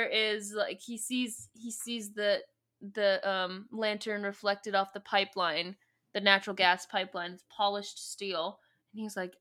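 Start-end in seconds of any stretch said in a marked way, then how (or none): none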